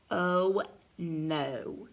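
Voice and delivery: low, slow storybook dread; mock dread. Reader foresees the waterfall, mock dread. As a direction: drop low and space the words ominously.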